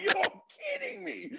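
high, laughing "kidding me"; starstruck disbelief. Retold or imitated. Meeting [name] by surprise, laughing high disbelief. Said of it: "I was like".

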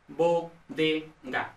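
bodega very slowly, broken into bo, de, ga; syllable by syllable. Shown as a demonstration. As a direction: spell out bodega in separate syllables. Mnemonic split into syllables. (bodega, sílaba por sílaba, muy despacio)

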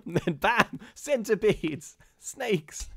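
high excited exclamations; mock shock. Host acting out the scary slides. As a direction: shout out the horrors.

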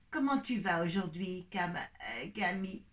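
voix de maman posée, bien plus grave. Acted. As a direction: une maman douce qui parle à sa fille. (la maman demande à Camille comment elle va, voix grave)

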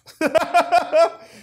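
big high burst of laughter; really cracks up loud. Real, not acted. Laughing at own joke.

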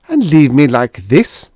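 mild shrill demand. Acted. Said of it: "Dora demanded shrilly".

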